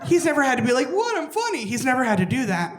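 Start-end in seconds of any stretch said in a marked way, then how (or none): 0.9-1.6 mock pleading. Imitating a desperate Tinder dater.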